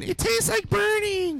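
put-on very high, slow, drawn-out child-like voice; hammed-up high-pitched quote; quoted cartoonish line. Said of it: "you know that line".

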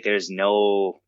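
ordinary delivery

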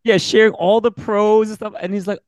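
normal talking voice

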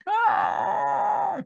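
high-pitched mock wail; exaggerated crying "ah"; imitated wailing cry. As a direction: imitate a friend bursting into a loud wail. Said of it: "he starts wailing, crying, like".

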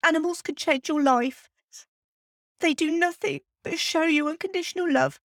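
choked up, fighting through real crying; tearful. Real, not acted. Podcaster thanking a late cat for her years, tearful. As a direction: voice lifting high as tears come. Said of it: "tell me about the crying".

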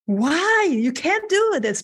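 high, drawn-out wail of protest, retold; stretched, high-pitched "how could you" objection; dismayed protest. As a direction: plead in mock dismay, pitch swooping up. Retold protest at being outed.

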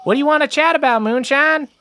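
high, quick heckler-style question; high-pitched villager's query; crowd member. Acted. Voices a listener in the crowd.

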